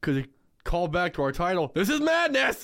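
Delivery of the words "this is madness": high-pitched, hammed-up shout of MADNESS; over-the-top theatrical yell; mock battle cry. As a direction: holler it like a big movie line. Quoting the title as callback.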